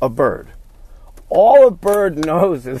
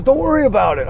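dismissive; 9 semitones higher, faster than usual. Retold or imitated. Neighbor brushing off question.

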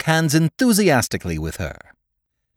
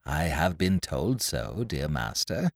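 softer, lower, coyly modest; 10 semitones lower, 9 dB quieter than usual. A narrator's reading. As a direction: bat your lashes with your voice. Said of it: "very coyly said".

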